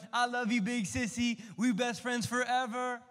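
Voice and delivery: put-on high, sugary little-girl voice; sweet sister. Little girl cooing to her brother, high and sweet. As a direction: play the sweet big sister. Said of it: "And she's like".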